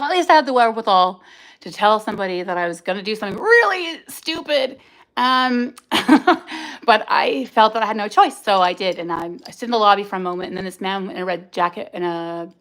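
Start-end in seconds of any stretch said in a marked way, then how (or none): 5.8-6.8 short breathy laugh, high; a quick airy laugh at her own folly; rueful laugh. Real, not acted. Laughing at herself while telling listeners about the risky meeting.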